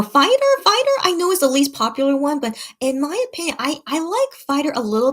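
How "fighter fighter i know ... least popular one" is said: bursts out high and loud on the song name, then settles; sudden fan excitement; excited outburst. Real, not acted. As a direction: blurt the title like a fan who can't hold it in. Excitedly naming favorite song.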